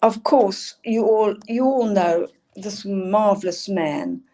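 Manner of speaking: ordinary talk, nothing marked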